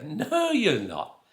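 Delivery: loud, high, drawn-out contradiction; hammed-up scoffing local; mock disbelief. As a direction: stretch out an incredulous denial. Locals flatly disbelieve him.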